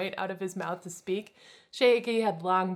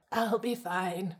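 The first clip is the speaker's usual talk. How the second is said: slow, weary murmur; tired; much slower than usual. Acted. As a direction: say it slow and worn out. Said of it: "a tired redhead responded".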